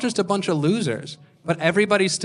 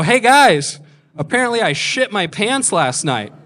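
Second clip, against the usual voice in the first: loud announcement; 6 semitones higher, 6 dB louder than usual. Retold or imitated. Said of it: "I announce to the rest of the show".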